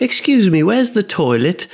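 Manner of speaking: put-on high British old-lady voice. Old lady asks in a shop, British accent. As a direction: imitate a polite British lady, pitched high. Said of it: "hearing toilet in that accent".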